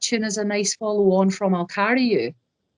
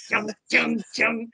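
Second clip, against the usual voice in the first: imitated guitar chugs; 4 semitones higher, slower than usual. Musician showing how he whacked his acoustic guitar, mock guitar chugs. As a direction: mouth the guitar chug, "Chung, chung". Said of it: "I was proper like".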